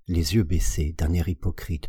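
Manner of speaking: ordinary delivery, nothing marked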